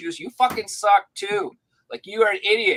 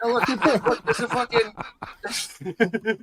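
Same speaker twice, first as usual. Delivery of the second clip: laughing disbelief; 6 semitones higher, much slower than usual. Retold or imitated. Dog finds something, laughing.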